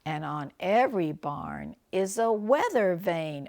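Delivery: ordinary delivery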